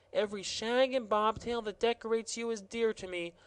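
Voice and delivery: high, syrupy gardener gushing; honeyed words. Acted. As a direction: fawn over him sweetly. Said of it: "murmuring in honeyed words".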